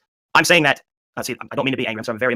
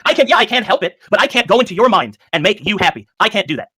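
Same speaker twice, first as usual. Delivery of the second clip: heated, high and louder insistence; real frustrated argument, voice raised; 7 semitones higher, 4 dB louder than usual. Real, not acted. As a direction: push back hard, pitch up. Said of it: "I, I raised my voice".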